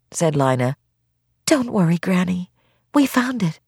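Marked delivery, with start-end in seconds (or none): 1.4-3.6 put-on reassuring girl. Lina soothing Granny.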